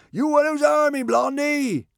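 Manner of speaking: high, loud heckle from a mob; jeering shout. A narrator's reading. Barbarians to their captive, high jeering shout. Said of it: "Shouts of".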